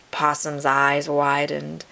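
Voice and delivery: ordinary delivery, nothing marked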